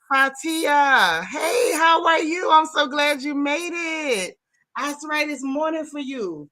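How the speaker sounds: high, slow, near-crying cry of delight; overjoyed greeting. Real, not acted. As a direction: greet her like a long-lost friend. Greeting viewer Fatia overseas.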